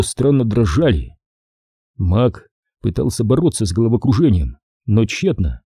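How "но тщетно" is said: plain reading voice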